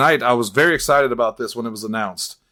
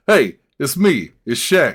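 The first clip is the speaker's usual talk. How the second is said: Barkley doing Shaq. Retold or imitated. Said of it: "Charles Barkley doing an impression of Shaq".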